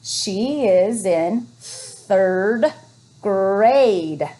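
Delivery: She is in third grade read very slowly; teacher modelling slow reading; slow reading aloud. Shown as a demonstration. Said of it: "Let's read it smoothly".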